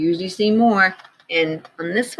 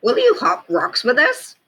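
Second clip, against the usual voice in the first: higher, louder playful frog; 11 semitones higher, 5 dB louder than usual. Acted. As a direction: ask brightly as the frog. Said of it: "said Frog".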